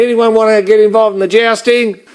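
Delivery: high, carrying announcer call. Retold or imitated. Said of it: "announcer just goes around saying".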